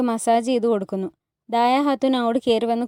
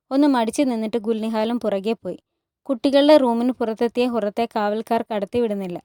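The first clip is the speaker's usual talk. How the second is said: ordinary delivery, nothing marked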